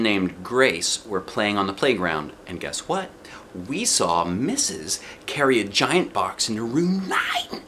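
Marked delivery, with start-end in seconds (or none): none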